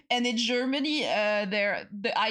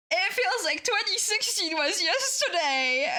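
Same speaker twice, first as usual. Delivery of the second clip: laughing; 8 semitones higher than usual. Real, not acted. Laughing at feeling old.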